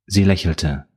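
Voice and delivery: usual speaking voice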